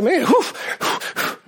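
imitated puffing. Preacher mimics Lazarus freeing his own face, puffing. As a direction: puff out short whews. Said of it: "took his hands and went like".

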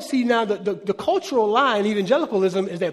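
ordinary talk

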